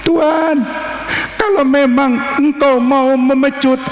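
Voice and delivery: a daughter begging, lightly tearful; tearful pleading daughter. Acted. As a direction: beg through tears to spare her father. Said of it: "lari, menangis".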